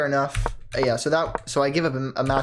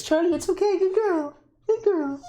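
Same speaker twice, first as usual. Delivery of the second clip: high cooing pet voice; dog talk; 13 semitones higher than usual. Real, not acted. Owner calming her crying dog Charlie, high cooing pet voice. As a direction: soothe the dog in a sing-song high voice.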